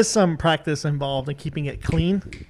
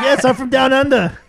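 higher-pitched, hammed-up Aussie accent; worst Australian accent; 6 semitones higher, 4 dB louder than usual. Joker doubles down on being from down under, bad Australian accent. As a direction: put on a terrible Australian accent. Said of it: "That was the worst accent of Australia".

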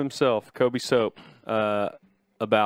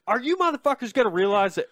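exasperated outburst; 7 semitones higher, faster than usual. Retold or imitated. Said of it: "I'm like".

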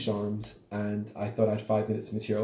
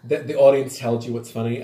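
normal talking voice

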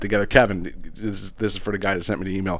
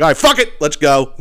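excited shout; 5 semitones higher, 8 dB louder than usual. Retold or imitated. Drunk decision, shouted.

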